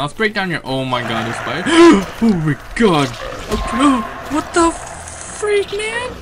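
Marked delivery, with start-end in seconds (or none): 1.7-6.1 high gasping fright, slow on the end; startled fright. Real, not acted. Gamer startled by a spider, high gasping fright. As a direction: gasp and shriek at the spider.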